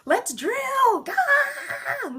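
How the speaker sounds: high, loud mock cry; mocking shout. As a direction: yell it like a frantic greedy driller. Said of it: "And we say".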